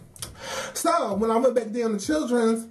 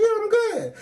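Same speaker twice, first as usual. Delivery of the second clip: fake fine; 13 semitones higher than usual. Brushing off coworker's concern.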